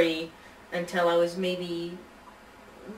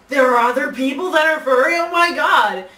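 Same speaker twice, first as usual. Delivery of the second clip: excited shout; 7 semitones higher, 14 dB louder than usual. Retold or imitated. Excited discovery at first convention.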